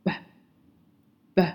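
b als unbehauchtes, weiches b nach s; b ohne Hauch, wie in „spot“; p read as b. Shown as a demonstration. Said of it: "我们会把它读成".

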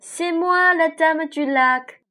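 high, loud lake spirit voice; lady of the lake. A narrator's reading. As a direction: give the lake lady a lifted voice. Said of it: "dit la voix du lac".

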